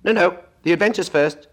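high, loud and impatient; impatient Gryphon. Acted. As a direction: hurry her along, impatient. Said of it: "in an impatient tone".

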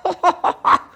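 very high squeaky chuckle; griffin chuckle. Acted. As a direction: cackle with glee. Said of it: "Then it chuckled".

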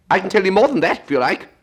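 a high, loud squawking Gryphon explaining. Acted. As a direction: pitch it high and bold as the Gryphon.

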